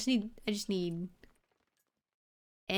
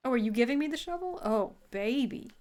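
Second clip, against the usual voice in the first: excited; 3 semitones higher, 4 dB louder than usual. Real, not acted. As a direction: gush with delight. Excited at the game's gift.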